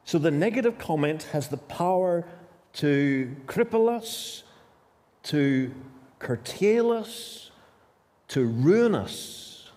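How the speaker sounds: ordinary talk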